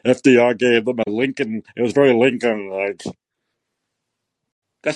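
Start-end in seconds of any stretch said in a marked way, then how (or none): 0.0-3.0 a hint of a droning historian mocked; mock Beschloss.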